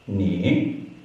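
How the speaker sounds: "Ni" gliding upwards; second tone. Shown as a demonstration. Teacher drilling second tone, "Ni" rising. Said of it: "going upwards".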